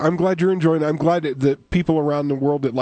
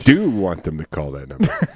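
deadpan low; 12 semitones lower than usual. Acted. Deadpan reply to cohost.

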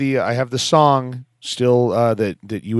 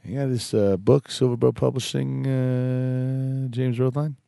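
bookstore caller; 4 dB quieter than usual. Pretend. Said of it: "Call the bookstore locally there and be like".

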